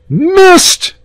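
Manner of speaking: very high, loud yell; crowd yell. Acted. Crowd watching the charge, loud high yell. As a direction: shout it as the charge misses. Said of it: "yelled the crowd".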